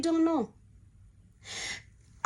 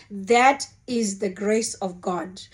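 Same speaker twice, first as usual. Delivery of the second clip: usual speaking voice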